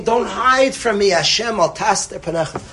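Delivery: a retold king's pleading call; retold scream. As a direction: cry out to God, half-performed.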